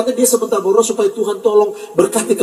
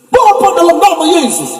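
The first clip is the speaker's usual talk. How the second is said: slow, louder, higher prayer opening; mock loud prayer; 5 semitones higher, 4 dB louder than usual. Preacher mocking people who pray loudly, slow loud prayer. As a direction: ham up a loud, shouted-out prayer. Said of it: "Kalau keras-keras, kuat-kuat".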